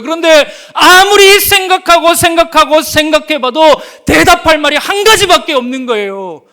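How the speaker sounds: normal talking voice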